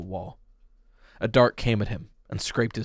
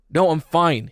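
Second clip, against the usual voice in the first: insistent; 6 semitones higher, 6 dB louder, slower than usual. Acted. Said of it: "he insisted".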